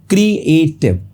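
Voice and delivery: "क्रिएटिव" in three beats, क्री-ए-टिव; split into syllables. Shown as a demonstration. Teacher repeating for students, "क्रिएटिव" split as क्री-ए-टिव. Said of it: "तीन syllable है".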